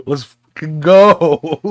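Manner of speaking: loud, high, slow mock battle cry; hyped-up yell. To friends at midnight, a slow hyped-up yell. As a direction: drag it out like a pumped-up gamer. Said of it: "It's like".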